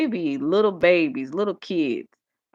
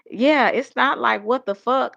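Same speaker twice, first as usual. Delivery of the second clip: put-on child's voice; 7 semitones higher, 3 dB louder than usual. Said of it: "great nephew said".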